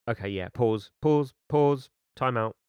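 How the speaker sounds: calling a halt. Real, not acted. Reader halting the story to comment, calling a halt. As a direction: break in loudly to stop everything.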